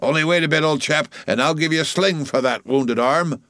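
cruel taunt. A narrator's reading. Flask calls to the wounded whale, cruel taunt. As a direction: taunt the old whale with false kindness. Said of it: "cried cruel Flask".

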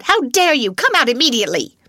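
loud, high, fast angry command; furious cry. A narrator's reading. Governess ordering the maid out, loud and furious. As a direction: bark the order at her. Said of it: "she cried out".

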